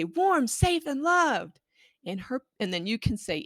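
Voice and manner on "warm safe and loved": put-on higher eager child's reply; a child finishing the line; child's eager answer. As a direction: answer like an excited kid. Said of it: "have them say".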